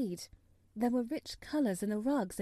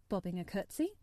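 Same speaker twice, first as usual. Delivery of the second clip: plain reading voice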